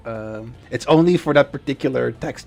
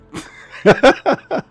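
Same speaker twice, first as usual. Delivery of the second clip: high laugh; 13 semitones higher, 11 dB louder than usual. Real, not acted. Excited laugh at viewer.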